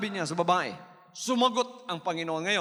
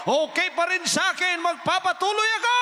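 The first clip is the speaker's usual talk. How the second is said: higher, louder resolve; determined declaration; 8 semitones higher, 5 dB louder than usual. Acted. Woman vowing to keep going, rising determined.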